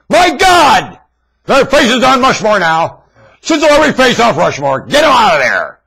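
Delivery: high, loud ranting cry; furious shout. Real, not acted. Ranter demands new faces on Rushmore, furious shout. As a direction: yell the demand at full force.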